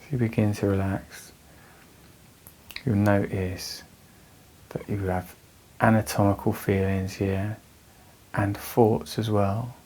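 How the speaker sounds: measured meditation voice with long pauses; guided meditation. Real, not acted. Teacher guiding anxious listeners through breathing, calm meditation voice. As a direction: guide them calmly through the breath, leaving space between phrases.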